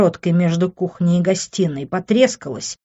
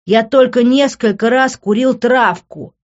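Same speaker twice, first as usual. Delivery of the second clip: slow, loud, high protest; emphatic, heated excuse; shouting; 6 semitones higher, 6 dB louder than usual. A narrator's reading. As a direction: shout it out word by word in self-defence. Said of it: "закричал я".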